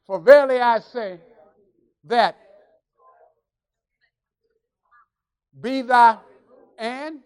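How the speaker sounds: very loud, word by word; booming read-aloud with long gaps; loud scripture reading. Real, not acted. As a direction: lead the congregation's reading at full volume. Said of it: "read this nice and loud".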